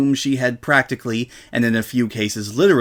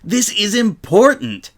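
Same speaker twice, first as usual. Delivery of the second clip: insistent; 9 semitones higher, 5 dB louder, slower than usual. A narrator's reading. Rainbow insisting to friends.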